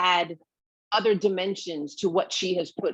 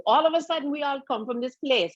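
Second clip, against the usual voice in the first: higher, lilting Guyanese accent; 8 semitones higher than usual. Shown as a demonstration. Cousins suddenly all from the same place, in a Guyanese accent. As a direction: slide playfully into Guyanese family speech. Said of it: "all of us start talking like this".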